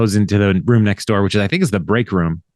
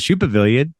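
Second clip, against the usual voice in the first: ordinary delivery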